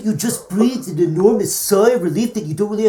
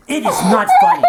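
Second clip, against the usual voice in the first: laughing protest; 5 dB louder than usual. Real, not acted. Mock anger while laughing.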